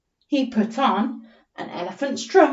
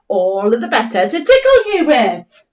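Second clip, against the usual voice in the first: louder playful tickle threat; lightly voiced chicken; 8 dB louder than usual. Teacher as chicken teasing the squirrel, louder. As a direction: tease as the chicken granny. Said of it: "The chicken said".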